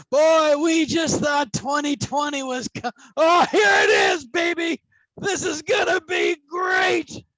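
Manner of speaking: loud, high whoop of overconfident joy; hammed-up excited shout. Gloating to the audience about the coming year, loud and high excited shouting. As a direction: yell it like the best year is coming.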